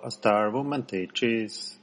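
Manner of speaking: ordinary talk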